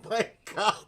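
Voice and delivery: high, loud disbelief at Daryl; shocked squeal. Real, not acted. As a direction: squeal his name in real shock.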